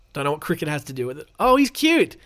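normal talking voice

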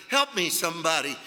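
normal talking voice

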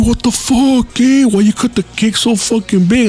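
high, outraged coworker voice, pitched well up; hammed-up shocked coworker complaining about the cake; mock indignant coworker. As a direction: scold him for the huge slice, voice jumping up. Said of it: "He's like".

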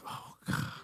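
acted dismay in a faint whisper; hushed dismay. Spouse reacting to the number to give, hushed dismay. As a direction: let it out as a voiceless gasp.

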